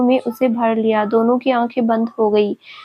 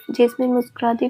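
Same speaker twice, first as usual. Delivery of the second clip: plain reading voice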